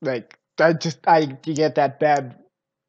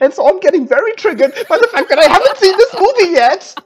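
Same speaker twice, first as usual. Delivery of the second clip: excited; 14 semitones higher, 6 dB louder than usual. Real, not acted. Fan upset about delayed dub.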